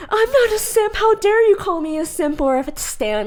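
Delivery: very high, offended squeal; mock indignant denial. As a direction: play the huffy fan insisting they're not a simp. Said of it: "you're like".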